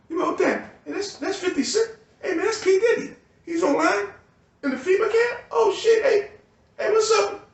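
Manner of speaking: high, gushing fan spotting a celebrity; mock excited fan. Fan spotting [name] in the FEMA line, high mock excitement.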